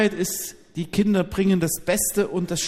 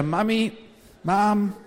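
higher, mock toddler call; imitated child's cry; 5 semitones higher, slower than usual. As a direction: lightly mimic a child crying out for mother. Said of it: "schrie er".

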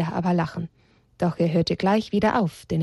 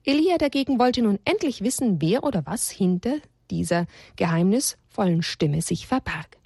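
ordinary delivery, nothing marked

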